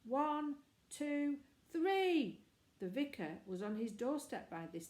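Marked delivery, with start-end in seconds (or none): 0.0-2.4 high, loud shouted count. A narrator's reading. Boy counting in a yell from the tower, shouting. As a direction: call the count out, building to three.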